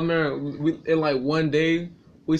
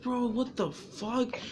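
stunned aside; 5 semitones higher, 11 dB quieter, much slower than usual. Retold or imitated. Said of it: "It was like".

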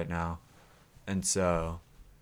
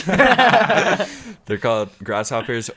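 laughing; 5 semitones higher, 9 dB louder, much slower than usual. Real, not acted. Giggling through the joke.

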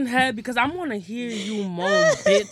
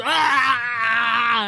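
open-mouthed "ah". Shown as a demonstration. Demonstrating why throat hurt.

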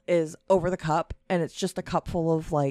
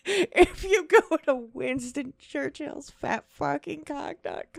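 amused disbelief; 11 semitones higher, 4 dB louder, slower than usual. Real, not acted. Incredulous laughing retort.

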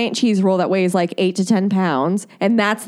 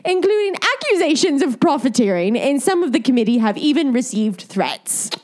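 quoted spokesman in a put-on English accent, pitched up; theatrical English official, laughing into the words; 5 semitones higher than usual. Reading the committee spokesman's statement in a put-on English accent. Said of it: "say in the accent".

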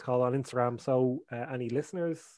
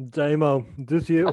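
loud laughing call at night; calling out, half laughing; mock phone call; 2 semitones higher, 6 dB louder, slower than usual. Imitates late-night phone call.

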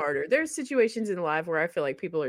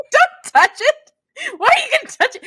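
alarmed plea; 10 dB louder than usual. Retold or imitated. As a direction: beg him to stop in mock alarm. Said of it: "You're like".

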